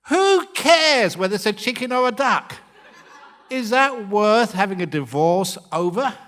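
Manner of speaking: loud, high rhetorical question; exasperated outburst. Acted. Monk gives couples the moral of the story, loudly exasperated. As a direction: cry it out in mock exasperation.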